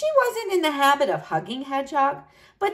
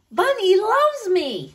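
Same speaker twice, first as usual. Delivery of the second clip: excited delight; 7 semitones higher than usual. Acted. Squirrel's happy discovery.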